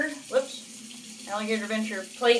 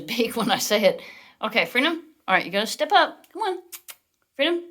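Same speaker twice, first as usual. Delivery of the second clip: ordinary talk, nothing marked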